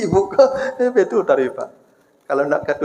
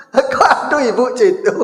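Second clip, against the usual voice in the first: laughing; 5 semitones higher than usual. Real, not acted. Preacher repeating the wife's answer, chuckling. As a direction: still chuckling through the words.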